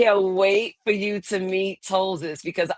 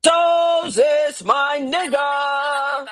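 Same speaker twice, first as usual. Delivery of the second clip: loud greeting shout; 9 semitones higher, 4 dB louder, much slower than usual. Real, not acted. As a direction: call out high and loud, drawn out. Calling out greeting to AI.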